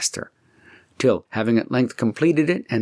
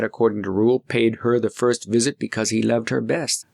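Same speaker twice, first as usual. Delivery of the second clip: plain reading voice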